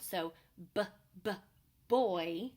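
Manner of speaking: put-on "b, b, boy" with the b sound isolated and repeated, then "boy" said loud; beginning sound demo. Shown as a demonstration. Teacher modelling the beginning sound for parents, b then boy. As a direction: tap out the b sound twice before "boy". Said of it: "start with the same beginning sound".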